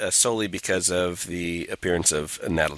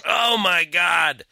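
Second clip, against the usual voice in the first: mock outrage; 10 semitones higher, 5 dB louder, much slower than usual. Mock outrage at Thor's language.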